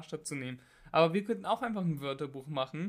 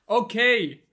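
booming, much louder and higher; too loud; 6 semitones higher, 12 dB louder than usual. Real, not acted. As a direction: overshoot into a loud shout. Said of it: "Nicht so laut". (viel zu laut)

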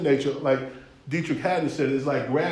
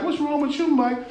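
higher, puzzled disbelief; startled; 11 semitones higher, 4 dB quieter than usual. Acted. Confronting a friend who suddenly switched, higher and startled. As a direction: ask like your friend turned strange. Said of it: "And you're like".

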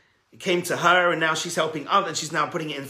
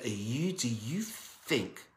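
scornful sneer; 4 semitones lower, 6 dB quieter, slower than usual. Retold or imitated. Miser sneering at poor visitors.

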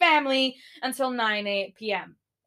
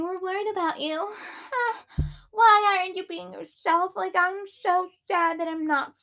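much higher, whiny mock voice; mocking friends' text; 8 semitones higher than usual. Vlogger mocking worried friends' text, high simpering. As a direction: read it in a simpering voice. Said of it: "texted me saying".

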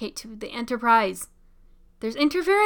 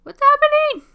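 excited; 10 dB louder than usual. Real, not acted. Tense scene, door about to open.

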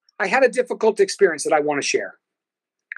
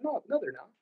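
hushed, higher-pitched inner voice; much softer, lifted aside to yourself; quiet self-reassurance; 4 semitones higher, 12 dB quieter than usual. Retold or imitated. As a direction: reassure yourself under your breath. Acted reassuring thought to himself.

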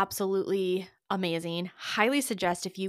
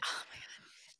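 breathless whispered awe; 17 dB quieter than usual. Real, not acted.

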